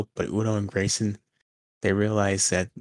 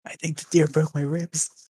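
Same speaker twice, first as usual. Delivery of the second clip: high, slow, whining hurt voice; injured voice; 7 semitones higher, slower than usual. Acted. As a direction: whimper it slowly as if injured. Plays injured Frankie.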